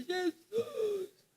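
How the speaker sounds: high, wailing call to Jesus; Brother Viana's cry. Retold or imitated. Preacher imitating Brother Viana's high wail. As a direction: wail his name like the old brother. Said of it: "irmão Viana".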